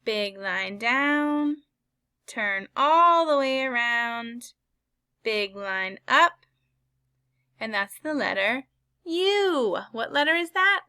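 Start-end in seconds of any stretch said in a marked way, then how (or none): 0.0-6.5 very slow, stretched guidance; slower demonstration. Shown as a demonstration. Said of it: "let's do it again". 9.0-10.8 high excited teacher voice; light up for the kids. Acted. Excited letter reveal to kids.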